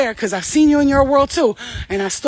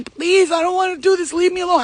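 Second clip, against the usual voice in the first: high, pleading imitation without real sobs; retold crying plea; 7 semitones higher than usual. Teenager crying to the pair who pressured her, retold high pleading. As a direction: beg to be left alone, voice pitched up. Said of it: "I ran down the stairs crying".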